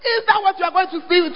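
ordinary delivery

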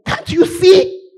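loud, high, half-sung rebuke; impassioned sung rebuke to the congregation; emotional outburst. Real, not acted. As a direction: pour it out, nearly singing. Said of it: "forgive me for getting emotional".

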